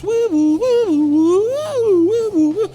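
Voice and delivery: very high siren wail, woo woo; siren imitation. Storyteller mimics approaching sirens, high and wailing. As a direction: mimic police sirens in falsetto.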